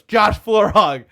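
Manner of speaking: loud, drawn-out announcer call; slow, high, booming name announcement; shouted stage introduction. Retold or imitated. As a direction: bring the comic on with a big holler. Host announcing comic to crowd.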